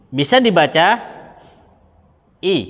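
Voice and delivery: "dibaca i" with a clear i sound; vowel i read clearly. Shown as a demonstration. Teacher spelling out "dibaca i" for the students. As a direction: say "i" as a plain i vowel. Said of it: "ini hamzahnya bisa dua bacaan".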